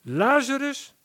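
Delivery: high, loud summoning cry; Jesus commanding Lazarus from the tomb, loud call. Acted. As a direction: call the dead man out with full power. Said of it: "riep hij met luide stem".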